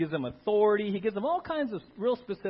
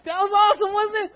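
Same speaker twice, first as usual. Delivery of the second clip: high, loud whooping cheers; hammed-up celebrating; hooting and hollering; 13 semitones higher, 13 dB louder than usual. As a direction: holler at Jesus in triumph. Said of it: "They're like".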